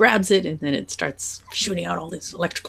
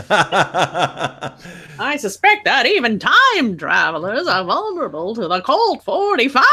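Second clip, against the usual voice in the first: slow, hammy Mark Twain parody; mock-grand drawl, leaning on each word; every word emphasized; 3 semitones higher, slower than usual. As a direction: stretch and stress "suspect", "time travelers" and "Colt forty-five". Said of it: "Emphasize every word".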